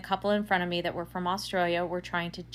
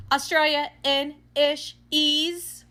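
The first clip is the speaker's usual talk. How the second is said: louder, higher, drawn-out syllable by syllable; imitated slow answer; 9 semitones higher, 7 dB louder, much slower than usual. Cashier naming what Australians speak, slow and loud. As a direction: imitate someone spelling out a made-up word slowly.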